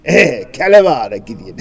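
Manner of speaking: loud, high Greek man's hail; Greek greeting. Retold or imitated. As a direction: call out the greeting like a hearty Greek man. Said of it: "One Greek man calmly says".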